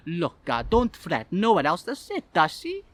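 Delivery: coaxing Italian waiter, rising at the end; Italian accent. Acted. Italian waiter at the children's table, coaxing accent. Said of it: "Obviously Italian".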